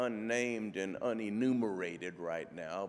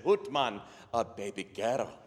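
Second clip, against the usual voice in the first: Scottish brogue settling back down; deflated Scottish echo of the news; Scottish father's reply; 4 dB louder than usual. Acted. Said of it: "And Jamie said".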